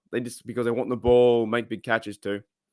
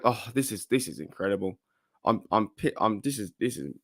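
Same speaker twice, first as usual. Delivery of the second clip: low quiet voice; 4 semitones lower, 6 dB quieter than usual. Shown as a demonstration. Host trying a lower voice to spare his throat, low and quiet. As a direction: lower and softer, a put-on low voice. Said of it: "might have to talk like this".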